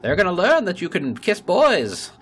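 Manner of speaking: high, loud barker's patter; carnival barker. Retold or imitated. Mocking the mayor as a loud carnival barker. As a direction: sell it like a sideshow barker. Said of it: "you sounded like a carnival barker".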